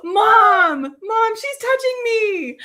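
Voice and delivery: high, slow mock wail through laughter; fake-cry to mom like a tattling child; whiny kid. Sisters joking like bickering kids.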